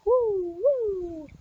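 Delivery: high, soft wooing wind; long, high "woo" of blowing wind; wind howl. A narrator's reading. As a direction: imitate the storm wind with airy woos. Imitates the stormy wind blowing.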